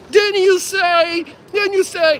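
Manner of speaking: high, slow, shrill-free mock yell of the prosecutor. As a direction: ham up a prosecutor yelling, hand on hip. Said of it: "She's like this".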